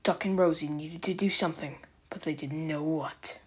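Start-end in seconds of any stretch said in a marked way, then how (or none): none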